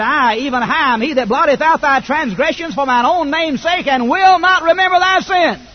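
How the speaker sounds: loud, rising preached scripture; scripture proclamation. Acted. Preacher booming God's promise from Isaiah. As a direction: proclaim God's words at full force.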